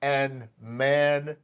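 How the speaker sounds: loud, punchy show opener; podcast host intro. Real, not acted. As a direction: kick off the show with big energy. Show host opens the episode.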